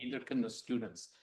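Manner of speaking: soft, low lecturer voice; barely audible. Shown as a demonstration. Showing how students can't hear the lecturer, very quiet. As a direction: keep it far under speaking volume. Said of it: "Did you, any of you understand a word".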